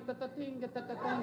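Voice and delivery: low, soft rhythmic mouth effects; flying-sandwich noises. Retold or imitated. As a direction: imitate objects zinging across a room. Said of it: "the sandwiches are going".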